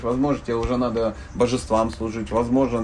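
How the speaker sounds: usual speaking voice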